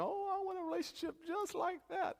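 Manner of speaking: high-pitched, exaggerated tearful wail; squeaky mock-crying swoon; mock weeping. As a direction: imitate a gushing, weepy movie fan. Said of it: "we cry and we weep".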